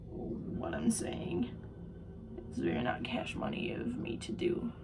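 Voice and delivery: low breathy whisper. Retold or imitated. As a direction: drop to a deep whisper. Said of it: "And I'm like".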